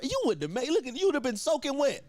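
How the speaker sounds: high-pitched, quick mock protest; exasperated imitation, pitched well up; insistent scolding. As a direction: hammer the point at a stubborn friend. Manager insists, scolding over rain.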